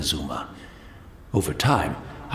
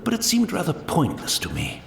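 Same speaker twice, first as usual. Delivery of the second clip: usual speaking voice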